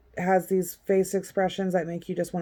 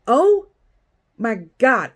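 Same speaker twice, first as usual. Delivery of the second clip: higher, louder gasp of disbelief; shocked; 3 semitones higher, 7 dB louder, slower than usual. Real, not acted. Reacting to fresh trial news, stunned. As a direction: blurt it out, stunned. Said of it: "baffled by news".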